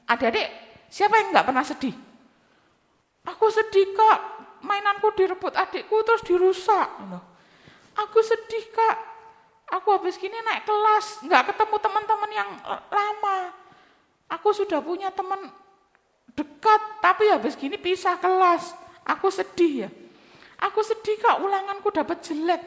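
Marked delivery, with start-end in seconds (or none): 3.3-6.9 put-on higher, childlike whining tone; child's complaint. Teacher voices a sad child. 8.0-22.6 put-on higher, childlike whining tone; child's complaint. Teacher voices sad children.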